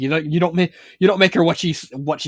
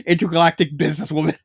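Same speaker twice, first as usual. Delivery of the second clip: ordinary talk, nothing marked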